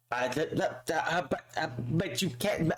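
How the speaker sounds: broken, stammered false starts; imitated stuttering. Manager reacting to the challenge, mock stutter. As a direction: sputter out syllables without finishing. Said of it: "stuttering and shit".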